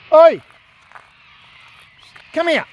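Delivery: sharp high call across the room; calling the dog. Real, not acted. To his dog Essie who wandered off, a high calling shout. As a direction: call the dog back firmly, pitched well up.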